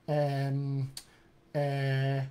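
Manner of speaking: drawn-out British "uh" and "um"; British hesitation. Shown as a demonstration. Coach shows a preferred accent's thinking sound, British hesitation. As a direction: hesitate as a British speaker would. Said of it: "If my preferred accent was British accent".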